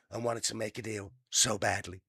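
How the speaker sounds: a hint of a put-on Trump read; lightly voiced boastful president; Trump impression. Reading the news line in a mock Trump voice. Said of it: "a bad impression".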